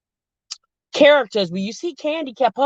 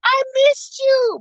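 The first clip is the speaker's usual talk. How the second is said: Karen gushing; much slower than usual. Retold or imitated. Wife greeting husband out of the car, high gushing. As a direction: overdo a clingy wife's sweetness. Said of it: "she's like".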